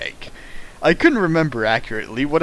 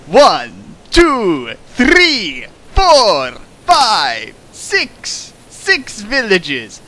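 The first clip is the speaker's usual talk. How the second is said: mock dramatic count; 8 semitones higher, 5 dB louder, much slower than usual.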